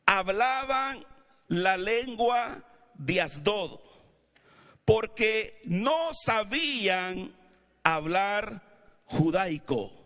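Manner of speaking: slow, measured reading with long pauses; solemn scripture reading. Acted. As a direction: read the verse out to the church, weighty and deliberate. Reads the Bible passage aloud.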